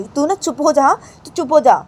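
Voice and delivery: father yelling at his brother to shut up; angry shout. Acted. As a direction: yell at him to shut up.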